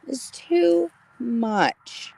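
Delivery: mock tearful protest to her son; touched. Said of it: "I was like".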